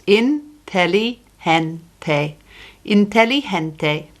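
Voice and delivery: Inteligente said in-te-li-HEN-te; Inteligente, all five syllables clear. Shown as a demonstration. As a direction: Inteligente with a throaty h for the g. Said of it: "It's got five syllables".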